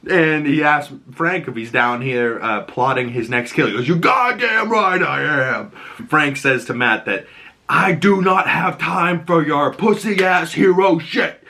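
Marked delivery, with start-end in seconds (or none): 3.9-5.7 slow, raised, hammed-up growl of agreement; defiant shout. Acting out Frank admitting he's plotting, slow defiant shout. 7.7-11.4 slow, higher mock-angry delivery; exasperated rebuke. Imitating Frank dismissing Matt, slow angry rebuke.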